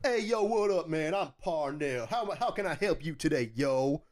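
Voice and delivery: high, lightly put-on street greeting; hammed-up hey yo bro voice. Mock stereotype greeting at start.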